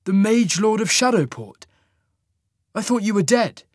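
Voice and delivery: high, loud disbelief; stunned, almost crying it out; shocked. Acted. Cole stunned by Marius.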